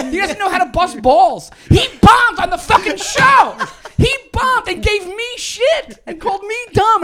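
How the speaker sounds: high, loud, indignant outburst; yelled rant. Real, not acted. Comic ranting about a colleague who bombed, indignant high yelling. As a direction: yell your grievance, hammering the key words. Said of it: "similar to what was just yelled".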